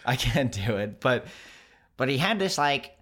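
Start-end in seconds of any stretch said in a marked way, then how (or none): 2.0-2.9 high, stretched Wisconsin twang; high-pitched Wisconsin voice. Retold or imitated. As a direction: mimic his high-pitched Wisconsin lilt. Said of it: "high-pitched voice".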